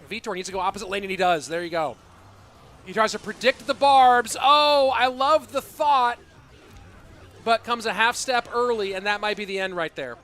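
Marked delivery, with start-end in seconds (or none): 2.8-9.2 loud, high hype call; excited casting. Real, not acted. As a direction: shout the play with rising excitement. Hype casting a big play.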